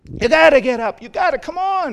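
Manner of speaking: high, loud nagging mom; mock mom yelling; mother nagging. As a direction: push the sleepy kid to get up. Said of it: "the mother just saying".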